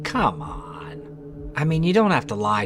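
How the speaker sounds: long drawn-out, incredulous swoop up and down; exasperated disbelief. Real, not acted. Host scoffing at the newspaper's explanation, exasperated. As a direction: stretch it out slowly, not buying it.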